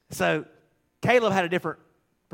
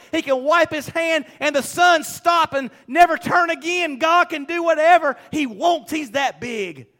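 high, loud, impassioned pulpit cry; full-force preaching; 8 semitones higher, 6 dB louder than usual. Real, not acted. Preacher proclaiming God's power to the congregation, full-force preaching. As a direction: build to a ringing declaration.

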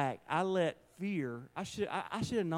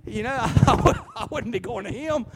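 laughing; 7 semitones higher, 8 dB louder than usual. Real, not acted. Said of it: "laughing at himself".

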